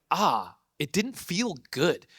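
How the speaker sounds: slow, drawn-out letdown; deflated. Retold or imitated. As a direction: sigh it out, hollow. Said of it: "I was like".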